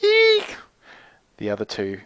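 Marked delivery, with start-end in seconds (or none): 0.0-0.6 a very high squeal of delight; fangirl eek. Retold or imitated. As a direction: squeal like losing your mind. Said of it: "Me losing my mind".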